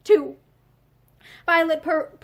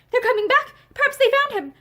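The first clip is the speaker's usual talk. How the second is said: high, louder hopeful cry; shaken whisper; 8 semitones higher, 5 dB louder than usual. A narrator's reading. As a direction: cry out in sudden hope. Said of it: "Her voice was a shaken whisper".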